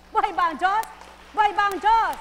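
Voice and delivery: very loud, high preacher's cry; rallying shout. Real, not acted. Preacher rousing the congregation with a loud cry. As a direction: shout it to the rafters.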